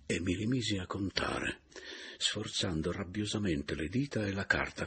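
usual speaking voice

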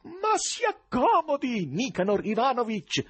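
very high, loud, drawn-out hospitality; shouted invitation. A narrator's reading. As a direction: yell the invitation without any embarrassment. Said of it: "urlava quello". (invito urlato, acuto e prolungato)

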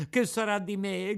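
high, crying question; tearful lament. A narrator's reading. Pinocchio alone on the beach, crying in despair. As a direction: wail it out in despair. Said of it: "continuava a piangere, a berciare".

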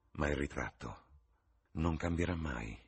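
understated, sighing resignation; a touch of wistful sighing. A narrator's reading. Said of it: "sospirò Hallward".

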